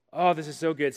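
normal talking voice

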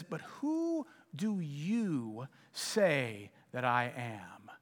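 slow, hushed, searching; intimate question. Acted. Jesus quietly asking his disciples, slow and intimate. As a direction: ask it softly, leaning in close. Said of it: "remarkably intimate question".

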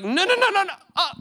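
very high, squeaky mock panic; scared. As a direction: squeal it like you regret it instantly. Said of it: "scared [name] mode".

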